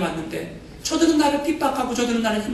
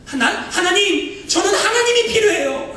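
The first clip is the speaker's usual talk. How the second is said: missionary's plea; 9 semitones higher than usual. Retold or imitated. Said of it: "이렇게 들리는 거예요".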